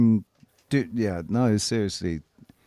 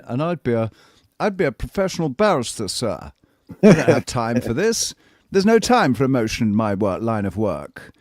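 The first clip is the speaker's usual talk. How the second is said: posh English accent; 4 dB louder than usual. Shown as a demonstration. Posh English accent, a barrister brushing off his friends. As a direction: play the haughty English barrister. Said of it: "this is how I'd be speaking as well".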